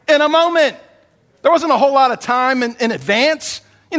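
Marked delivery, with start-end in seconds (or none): 0.1-0.8 laughing. Shown as a demonstration. Repeated with laugh, higher.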